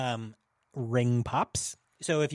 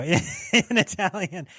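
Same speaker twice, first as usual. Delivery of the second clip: usual speaking voice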